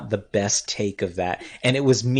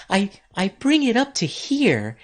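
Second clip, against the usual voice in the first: much higher version of own voice; pitched up high; 9 semitones higher than usual. Shown as a demonstration. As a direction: push the voice way up high. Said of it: "my voice, but higher".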